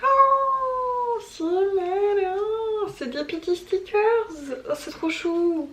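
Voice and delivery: high, drawn-out "oh" of delight; delighted cooing. Real, not acted. Reader discovering little gifts in her book, delighted cooing. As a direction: coo over something tiny and adorable.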